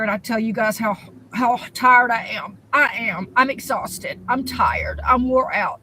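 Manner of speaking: normal talking voice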